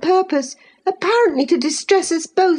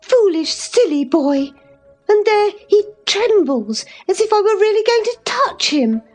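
put-on high-pitched, scolding young lady; in a passion; 12 semitones higher than usual. A narrator's reading. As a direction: scold him with scornful exasperation, voice pitched high. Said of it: "cried Catherine in a passion".

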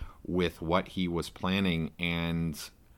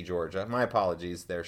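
normal talking voice

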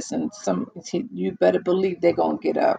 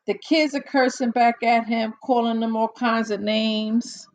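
ordinary talk

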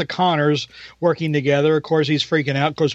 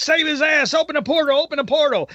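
higher pitched pleading, imitating Kane; mock desperate begging; 9 semitones higher, faster than usual. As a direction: plead like a panicked man being chased, hammed-up. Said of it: "he's begging somebody to save his ass".

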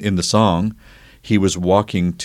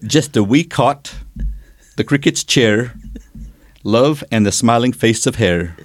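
Irish accent; 3 semitones higher than usual. Shown as a demonstration. Asked by a friend to repeat the poem in an Irish accent. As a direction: say the verse again in a deliberate Irish lilt. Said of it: "say it with the accent again".

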